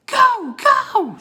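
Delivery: high, loud yell at the wolf; shout. Acted. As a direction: drive the wolf back with your voice. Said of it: "shouted, shouted Jack".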